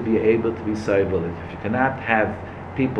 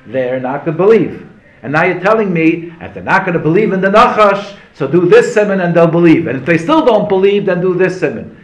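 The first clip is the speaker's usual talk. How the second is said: higher, louder, building argument; heated rebuttal in a raised voice; impassioned protest; 6 semitones higher, 6 dB louder than usual. Acted. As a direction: protest with rising insistence, voice lifted. Acted protest in a retold argument.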